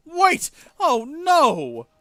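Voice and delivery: loud, high cry of dismay; alarmed realisation. Acted. Goat owner finding his recipe mistake, loudly alarmed. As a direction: exclaim in sudden alarm.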